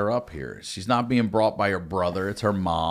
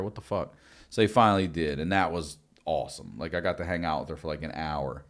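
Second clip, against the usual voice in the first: usual speaking voice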